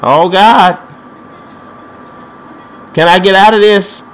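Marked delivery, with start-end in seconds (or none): none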